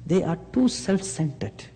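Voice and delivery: normal talking voice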